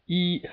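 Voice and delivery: E held high and level; first tone. Shown as a demonstration. Teacher shows learners the first tone, steady high E. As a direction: say E on a steady high pitch, no change. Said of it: "It sounds like this".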